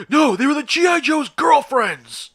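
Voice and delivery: put-on loud, high, drawn-out kid's protest; slow, high-pitched mock whine, very loud; indignant kid impression. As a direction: mimic a defensive kid wailing an objection. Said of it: "He's one of those kids".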